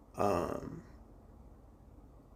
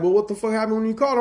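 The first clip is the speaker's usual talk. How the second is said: much higher, fast, louder demand; brother-in-law's question; 10 semitones higher, 6 dB louder, faster than usual. Retold or imitated. Brother-in-law pressing him for details, fast high question. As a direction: fire the question off quickly.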